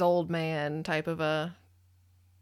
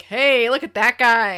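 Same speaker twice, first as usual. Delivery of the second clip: mock admiring; 4 semitones higher, 11 dB louder, slower than usual. Mock admiring the bad boy.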